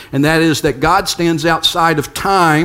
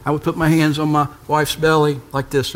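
normal talking voice

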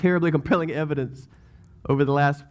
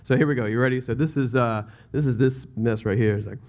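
ordinary delivery

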